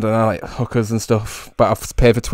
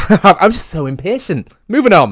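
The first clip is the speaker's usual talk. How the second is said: laughing; 8 semitones higher, 6 dB louder than usual. Real, not acted.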